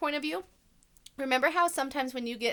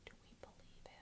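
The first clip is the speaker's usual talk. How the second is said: soft unvoiced whisper; whispered question; 30 dB quieter, faster than usual. Real, not acted. Teacher whispering a question to her class.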